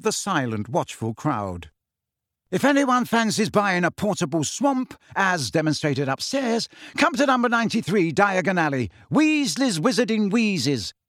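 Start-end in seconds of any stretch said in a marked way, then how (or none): 2.5-10.9 higher, showman's sales pitch; loud announcement. A narrator's reading. Fred pitching his shop to the crowd, loudly. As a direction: advertise to the whole hall. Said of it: "he said in a loud voice".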